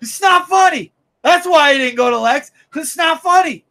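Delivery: angry fan yelling about the book; angry shout. Real, not acted. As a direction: hammer it home with frustration. Ranting, listener says spitting.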